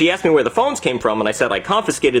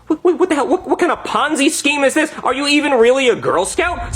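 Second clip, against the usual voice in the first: high, incredulous sputtering; outraged disbelief; 12 semitones higher than usual. Retold or imitated. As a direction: demand answers in high-pitched outrage. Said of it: "I'm like".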